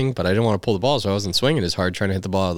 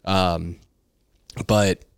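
usual speaking voice

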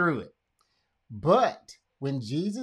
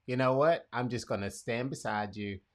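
low, soft, tender reassurance; quiet presence; 5 semitones lower, 5 dB quieter than usual. Pretend. Sitting beside someone grieving, quiet and tender. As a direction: offer company gently and softly. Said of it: "just have the presence".

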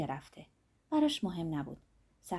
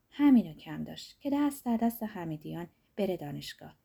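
usual speaking voice

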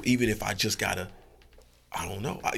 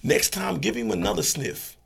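calm advising dog; 3 semitones higher, 6 dB louder than usual. Acted. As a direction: coach him gently. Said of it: "he would be like".

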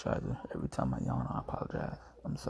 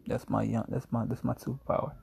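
usual speaking voice

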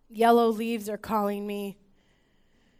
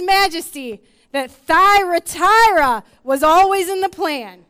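loud, higher, building climactic call; full-force preaching; 5 semitones higher, 10 dB louder than usual. Acted. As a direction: lift the room into worship.

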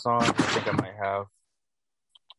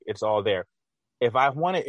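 ordinary delivery

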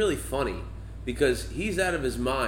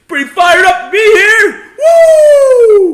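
far higher, much louder over-the-top shout; hyped Steve Ballmer; 19 dB louder, much slower than usual. Retold or imitated. Joking about a quarterly meeting entrance, wildly shouting. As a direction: storm in roaring like a pumped-up exec. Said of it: "Steve Ballmer walks in like".

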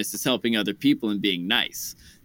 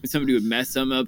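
ordinary talk, nothing marked

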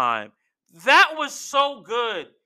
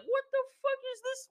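very high, softened mock disbelief; retold bewildered squeal; 8 dB quieter, faster than usual. Acting out Roman baffled at the interruption, high-pitched.

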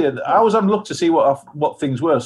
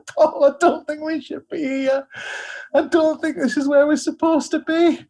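higher, slower, half-laughing worried plea; imitating her scared drunken self, pitched up and slow; mock frightened whimper; 9 semitones higher than usual. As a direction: play the lost, frightened drunk. Drunk, lost, pleading to Paul.

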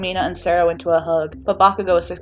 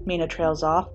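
plain reading voice

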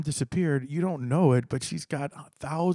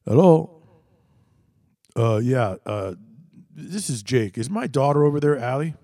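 angry caller; 6 dB louder than usual. Retold or imitated. Drunk father calls angrily.

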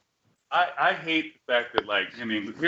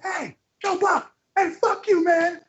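much higher, slow mocking heckle; high mock heckler shouting at Joe Buck; slower than usual. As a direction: yell it like a passing heckler. Heckler in background voiced.

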